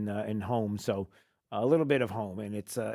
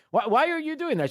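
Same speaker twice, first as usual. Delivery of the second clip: exasperated; 11 semitones higher, 4 dB louder than usual. Retold or imitated. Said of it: "It's like".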